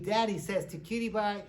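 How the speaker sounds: ordinary talk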